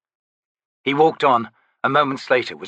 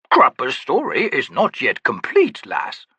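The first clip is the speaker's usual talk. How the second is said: lofty, theatrical, higher; grand old Daru; 3 semitones higher than usual. Acted. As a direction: play the eccentric old trickster. Daru Cropper speaks.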